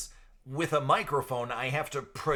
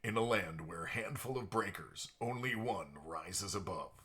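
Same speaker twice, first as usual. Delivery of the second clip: movie trailer voice; 4 semitones lower, 6 dB quieter than usual. Pretend. Mock movie trailer line.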